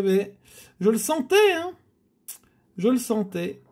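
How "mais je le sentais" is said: ordinary talk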